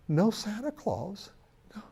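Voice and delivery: slow, soft, high and heartbroken; a crushed four-year-old near tears; tearful little boy. Acted. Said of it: "and he's crying".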